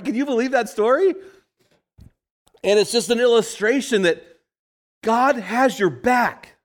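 high, loud preaching build. Acted. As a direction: lift it up and proclaim it to the men. Preacher rising into exhortation.

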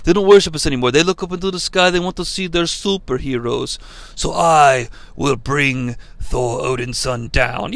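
Zeus's grand speech in a hammed-up Italian accent; Italian accent fading low and soft. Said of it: "This is me doing the Italian accent".

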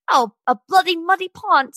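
hammed-up British singer accent, badly faked. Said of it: "Let me get my best [name] on".

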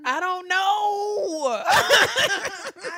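high, loud, drawn-out wail; laughing, wailed confusion; exasperated bafflement. Real, not acted. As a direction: stretch it out in comic despair. Baffled by the mispronunciation.

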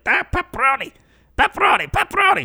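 put-on high, loud squawk of a frantic child; hammed-up excited kid voice; freaking-out little kid. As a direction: imitate a small kid going nuts over food. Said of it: "he's, like, freaking out".

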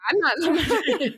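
a high laugh breaking into the words. Real, not acted. [name] laughs while answering her co-host about Willie. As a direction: laugh it off mid-sentence.

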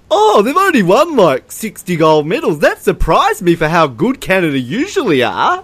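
mock astonished commentator, pitched high; Andrew Gaze. Podcaster imitates TV commentator on Canada's medals, mock surprise. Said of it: "Andrew Gaze literally goes".

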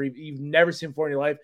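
ordinary talk, nothing marked

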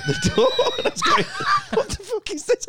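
words squeezed out high through laughter; can barely get it out for laughing; giggling while telling the crash story. Real, not acted.

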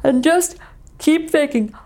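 yawning through the words; fake yawn. Shown as a demonstration. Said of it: "we're going to fake yawn".

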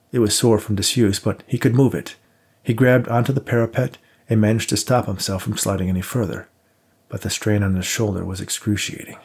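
ordinary delivery

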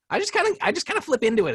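high, quick cartoon-character voice. Shown as a demonstration. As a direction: flip suddenly into a high-pitched character. Demonstrates slipping into Frank's voice.

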